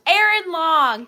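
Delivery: loud, slow announcement of the name; calling out a name. Real, not acted. Host calls out the winner's name, loud and drawn out. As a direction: call the name out to the whole room.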